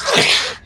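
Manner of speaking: harsh throaty gurgle; choking death rasp. Acted. Game master imitating a man's throat torn out, rasping gurgle. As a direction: make the rasp of a ripped throat.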